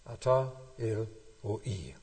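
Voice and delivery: „Ata El Roi“ slowly and deliberately repeated; slow Hebrew repeat. Shown as a demonstration. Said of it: "Im Hebräischen drei Worte". („Ata El Roi“ langsam und bedächtig wiederholt)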